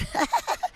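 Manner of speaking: high raspy cackle; unhinged villain cackle; raspy laugh. Real, not acted. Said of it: "it's, like, raspy".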